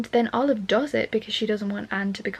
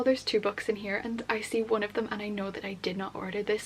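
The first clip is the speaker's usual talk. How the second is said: normal talking voice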